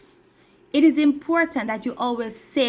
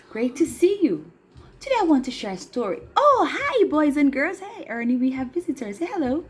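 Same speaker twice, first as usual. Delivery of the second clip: ordinary delivery, nothing marked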